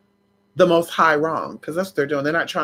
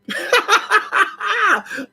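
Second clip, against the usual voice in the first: very high crying laugh; real shrieking cackle. Real, not acted. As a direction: burst into squealing laughter.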